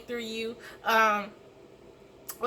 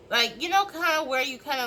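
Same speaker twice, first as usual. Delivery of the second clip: normal talking voice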